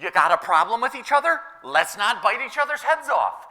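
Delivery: retold scolding. Acting out the apostle's scolding.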